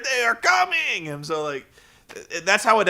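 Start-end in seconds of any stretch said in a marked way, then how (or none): 0.0-1.1 hammed-up wrestling villain promo; Crimson promo. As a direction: declare it like a goofy vampire. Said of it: "He's like".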